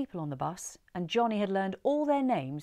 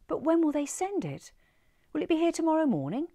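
higher, louder, anxious questions; anxious pleading; 10 semitones higher, 5 dB louder than usual. Acted. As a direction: plead for the lost bag in a rush.

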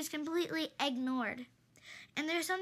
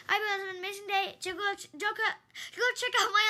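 rushed sign-off; 4 semitones higher, 4 dB louder than usual. Real, not acted.

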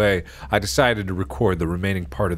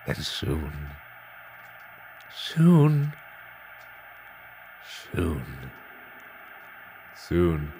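eerie repeated whisper; 4 semitones lower, 7 dB quieter, much slower than usual. Acted. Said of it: "looped the last word over and over".

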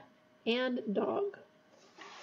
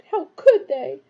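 higher and louder distressed protest; choked up; 5 semitones higher, 9 dB louder, slower than usual. A narrator's reading. Hermione protests the execution, choked and louder. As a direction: press the question out in upset disbelief. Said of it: "she choked".

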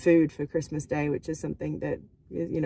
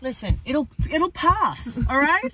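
urgently needing to pee; squirming, desperate for the toilet; 10 semitones higher than usual. Real, not acted. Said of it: "I just really need to pee".

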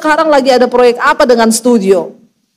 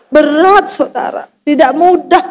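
ordinary delivery

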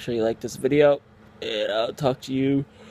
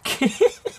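high squeaky laugh; 13 semitones higher, 6 dB louder than usual. Real, not acted. Laughs at himself.

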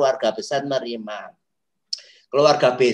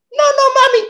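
put-on much higher, louder child's protest; whining child; 10 dB louder than usual. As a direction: refuse like a whiny little kid. Child refusing his mother. (rengekan anak kecil yang menolak)

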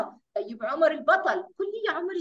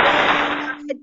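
name called out louder; 6 dB louder, much slower than usual. Real, not acted. Teacher calling child to answer.

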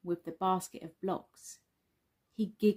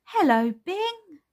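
high, loud, drawn-out hello; excited child character, slow and high; excited greeting; 11 semitones higher, 7 dB louder, slower than usual. Acted. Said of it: "says Sula excitedly".

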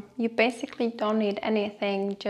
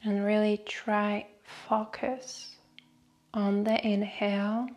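guided meditation. Acted. Host leading listeners through a short breathing meditation, guided meditation voice. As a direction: guide them unhurriedly, letting each phrase settle.

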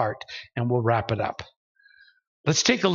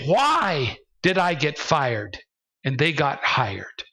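ordinary delivery

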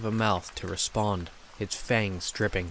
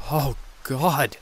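choked; 5 semitones higher than usual. Acted. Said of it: "I choked".

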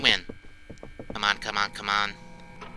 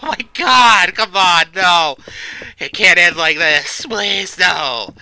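panicked laughing; 5 semitones higher, 5 dB louder than usual. Real, not acted. Being chased, laughing in panic.

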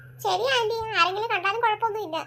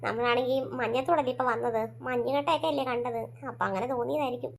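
reassuring mother, lower pitch; 9 semitones lower than usual. Acted. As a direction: lower voice of a mother calming her child. Mother voice soothing daughter.

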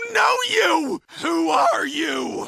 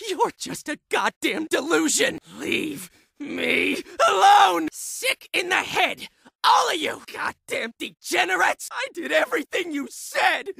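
agitated patient lashing out at voices; frantic, erratic outburst; tormented, deranged shouting. Acted. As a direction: shout as if cornered and losing your mind.

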